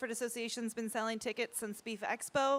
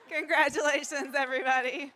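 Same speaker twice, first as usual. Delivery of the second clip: bright laughing cheer; laughing congratulations; 3 semitones higher, 4 dB louder than usual. Real, not acted. Laughing after naming winner.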